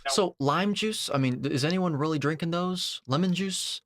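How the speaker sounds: high-pitched, disbelieving questions; incredulous. Real, not acted. Incredulous reaction to the clip.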